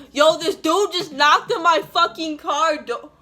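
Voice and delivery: retold angry yelling about the man. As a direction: yell about the stalker to the camera. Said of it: "a video of me yelling".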